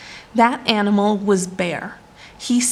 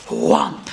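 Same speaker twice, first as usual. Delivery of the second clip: low thudding whomp; a heavy paw slamming down. Acted. Bear's paw slams down.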